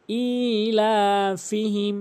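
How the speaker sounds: ইলাফিহিম chanted with long ই and লা; correct elongation. Shown as a demonstration. Teacher shows one alif of length, slow chanted ইলাফিহিম. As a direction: draw out the opening ই of ইলাফিহিম on a held note. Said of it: "এক আলিফ টেনে পড়তে হবে".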